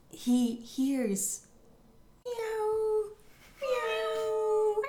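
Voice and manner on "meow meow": high drawn-out mock meow; a cat's meow, done high and long. Cat heard in story.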